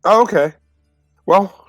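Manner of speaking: ordinary delivery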